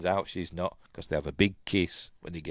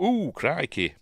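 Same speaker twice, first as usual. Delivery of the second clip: mock startled Clint; 6 semitones higher than usual. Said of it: "making Clint Eastwood go".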